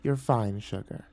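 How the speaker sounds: soft, trailing reassurance; sighed. A narrator's reading. Nymph dismissing the guard, sighing. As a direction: sigh it away, dropping very quiet. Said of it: "Miss Dahlia sighed".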